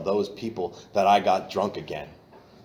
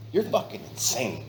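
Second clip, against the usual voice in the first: ordinary talk, nothing marked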